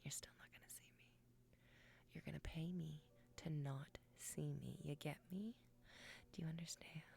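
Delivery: intimate whisper throughout; conspiratorial whisper; mock-seductive whisper. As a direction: tease them in a hush. Whispered teasing to chat.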